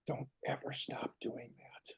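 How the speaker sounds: soft unvoiced whisper to a child. Father whispers to his son in the dark nursery. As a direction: whisper it tenderly at the bedside.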